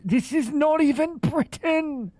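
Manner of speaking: this is not even Britain, cry it out incredulous; indignant outburst. Real, not acted. Streamer shocked by a fake British platter, high incredulous outburst. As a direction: pitch jumps way up in disbelief.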